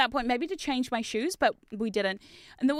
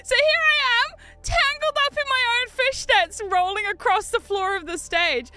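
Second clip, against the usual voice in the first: high, laughing through the words; crying with laughter; 11 semitones higher, 5 dB louder than usual. Real, not acted. Laughing while retelling the fishnet tangle.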